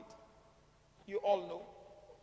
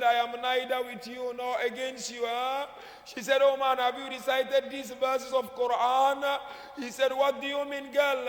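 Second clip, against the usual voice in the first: louder, rising sermon voice that builds to a cry; impassioned majlis preaching; 3 dB louder than usual. Acted. Preacher to mourners, pouring out the tragedy loudly. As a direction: preach the tragedy at full force.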